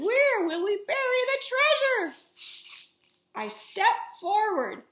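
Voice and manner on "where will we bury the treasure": high, loud anguished question; desperate shout. Acted. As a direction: yell it over the storm. Captain panics in storm.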